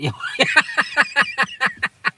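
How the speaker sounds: high, loud burst of laughter; laughing. Real, not acted. Amused by the midges and accent talk, laughing.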